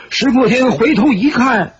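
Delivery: usual speaking voice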